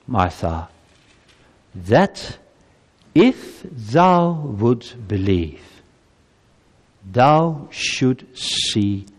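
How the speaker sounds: slow, weighty scripture reading. Acted. Preacher reading Jesus' words to Martha slowly. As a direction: read it slowly, word by word. Reads closing verse slowly.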